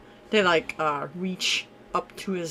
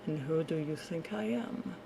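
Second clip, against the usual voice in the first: soft velvety lord voice; honeyed voice; 3 semitones lower, 10 dB quieter than usual. Acted. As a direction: purr it softly. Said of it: "His soft, velvety voice".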